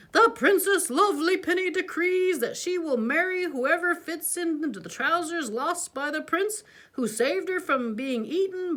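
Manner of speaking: mock grand decree; herald. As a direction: announce it like a royal crier. Reading a royal proclamation.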